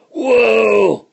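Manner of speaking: high, loud, falling holler; startled cry. Acted. Stagecoach driver just had his hat shot off, startled cry. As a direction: cry out in storybook alarm.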